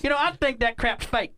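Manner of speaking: high Southern yokel sneer; southern twang. Shown as a demonstration. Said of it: "in a southern twang".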